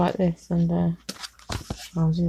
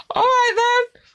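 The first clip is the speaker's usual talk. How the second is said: much higher, giggling through it; laughing. Acted. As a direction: agree while cracking up. Giggling acted reply.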